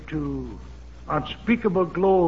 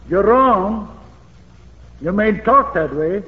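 louder, scolding retelling of Peter's rebuke; mock rebuke; 2 semitones higher, 7 dB louder than usual. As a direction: scold him like a brash disciple, louder. Peter rebuking Jesus, retold.

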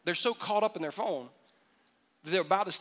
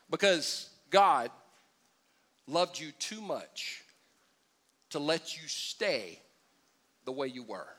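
slow tender emphasis; 5 semitones lower, 4 dB quieter, slower than usual. Real, not acted. Slow soft preaching.

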